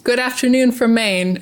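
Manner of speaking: ordinary talk, nothing marked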